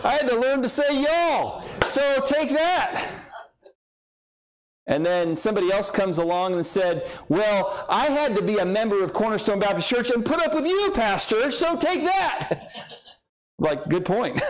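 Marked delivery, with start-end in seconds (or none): 0.0-3.1 high-pitched comic one-upmanship; mock boasting. Mock boasting in imagined contest. 7.3-12.7 high, rising comic one-upmanship; mock indignant boast. Church member's mock boast to pastor.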